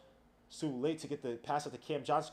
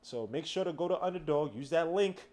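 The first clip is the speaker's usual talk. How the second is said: ad read; 2 semitones higher, 5 dB louder than usual. Acted. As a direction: sell it to the viewers. Sponsor ad read.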